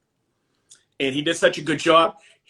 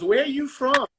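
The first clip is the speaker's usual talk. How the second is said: much higher-pitched curious question; quoted dental hygienist; 11 semitones higher than usual. Retold or imitated. Dental hygienist asking a patient where he's from, imitated higher voice. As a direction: lightly voice the hygienist, pitched well up. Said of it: "and she goes".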